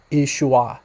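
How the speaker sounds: "Ishua" as ee-shoo-ah, final vowel sounded; older pronunciation. Shown as a demonstration. Teacher repeating the possible earlier form of the name, older pronunciation. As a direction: give "Ishua" its third syllable, an open ah. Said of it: "earlier on it was pronounced".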